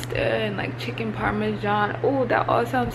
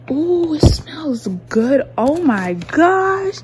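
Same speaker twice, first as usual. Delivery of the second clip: slow, louder swoon over the smell; drawn-out food delight; 6 semitones higher, 5 dB louder, much slower than usual. Real, not acted. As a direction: savor the smell out loud.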